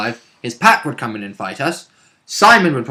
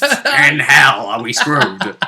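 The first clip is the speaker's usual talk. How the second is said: mock doom; 3 dB louder, much slower than usual. Mock doom about Mansions.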